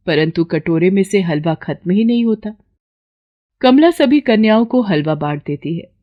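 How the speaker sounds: ordinary delivery, nothing marked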